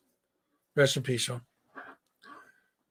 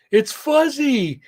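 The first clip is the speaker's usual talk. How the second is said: put-on much higher, slow baby voice; 11 semitones higher, much slower than usual. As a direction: stretch the nickname out like a toddler. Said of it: "I hear".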